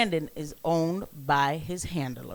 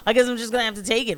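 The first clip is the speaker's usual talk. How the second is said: ordinary talk, nothing marked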